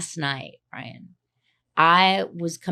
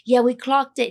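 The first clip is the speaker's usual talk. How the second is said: lightly imitated British accent, pitched up; 5 semitones higher, 4 dB louder than usual. Quoting the British driver who picked her up, imitated British accent. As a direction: put on a touch of British. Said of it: "he had, like, a British accent".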